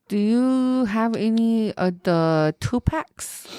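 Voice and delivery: slow mock-clueless request; mocking, drawn-out question; clueless customer. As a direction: stretch it out like a clueless shopper. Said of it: "girl in high school that said [name]".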